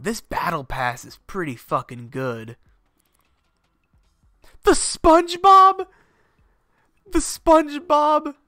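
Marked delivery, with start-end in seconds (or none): 4.6-5.9 high, loud, slow stunned question; can't believe what he sees; surprised. Real, not acted. Spotting the SpongeBob skin, stunned disbelief. 7.1-8.3 surprised. Real, not acted. Repeats in disbelief.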